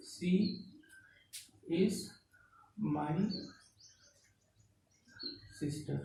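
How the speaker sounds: soft, separated words at writing speed; word-by-word dictation. Real, not acted. Teacher writing out an example for students, slow word-by-word dictation. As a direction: leave a long pause after every word.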